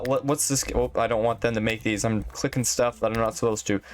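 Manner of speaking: usual speaking voice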